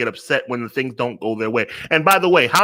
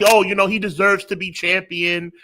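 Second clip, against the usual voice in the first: higher-pitched mock sympathy; mocking imitation; 6 semitones higher than usual. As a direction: parody someone pleading for a wrestler. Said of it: "You're never gonna hear me talk like that".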